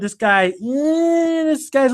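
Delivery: high, long-drawn doubtful "eh"; sneaky suspicion. Retold or imitated. Recalling early doubts about Monroe, drawn-out mock suspicion. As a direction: stretch it out high, acting unsure of him. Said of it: "I always had a sneaky suspicion".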